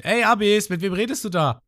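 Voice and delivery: lauter, hoher Ruf; laut quer durch die Höhle rufen; Ruffy schreit. Retold or imitated. (Ruffy ruft laut nach Abyss, hoch und laut)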